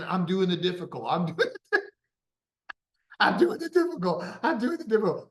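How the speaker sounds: high strained voice breaking into laughter; mock straining. Client straining through the trainer's exercises, hammed-up effort. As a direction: act out struggling through a hard set.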